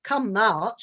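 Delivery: ordinary talk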